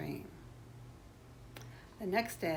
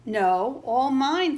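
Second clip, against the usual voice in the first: loud, high protest; greedy counterclaim; 7 semitones higher, 10 dB louder, slower than usual. A narrator's reading. Yellow noodle disputes the pie with his brother, loud greedy protest. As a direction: outdo the rival's claim loudly. Boys quarrel over the pie.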